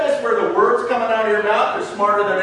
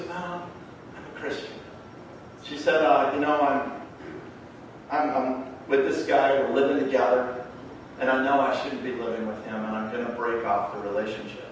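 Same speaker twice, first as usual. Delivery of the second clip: low, subdued retold confession; confessing girlfriend; 7 semitones lower, 7 dB quieter than usual. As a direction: trail down softly as you admit it. Girlfriend confesses her living situation.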